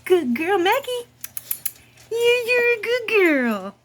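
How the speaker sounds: high, sing-song pet talk; doggy praise voice. Real, not acted. Praising her dog.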